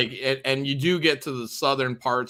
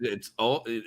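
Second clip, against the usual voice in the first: normal talking voice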